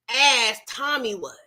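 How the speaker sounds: ordinary talk, nothing marked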